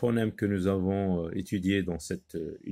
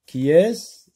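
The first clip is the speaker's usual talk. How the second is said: qui EST-CE with a sharp rising question tune; teacher modelling the question qui est-ce; questioning rise; 7 semitones higher, 8 dB louder than usual. Shown as a demonstration. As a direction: ask qui est-ce loud and high, like quizzing the class. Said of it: "On pose toujours la question qui est-ce".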